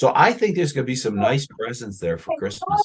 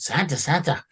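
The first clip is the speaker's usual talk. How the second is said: eager elf voice; 5 semitones higher than usual. Acted. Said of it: "he talks like that".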